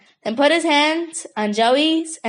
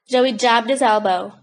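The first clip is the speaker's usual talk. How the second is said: ordinary delivery, nothing marked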